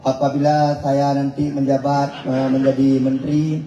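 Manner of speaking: a mock Aceh-accented Indonesian address. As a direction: mimic a governor's Aceh-accented speech. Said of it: "Dia tetap dengan logat Acehnya".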